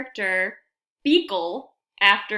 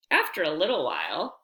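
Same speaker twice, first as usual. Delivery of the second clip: usual speaking voice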